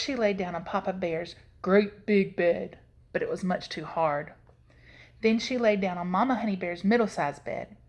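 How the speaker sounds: ordinary delivery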